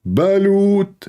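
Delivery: long drawn vendor shout; street vendor's call. Retold or imitated. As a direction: call out your wares on an empty beach. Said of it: "he starts shouting".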